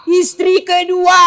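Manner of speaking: teasing reveal to the rich wives; slow punchline. Acted. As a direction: land Istri kedua slow for the laugh.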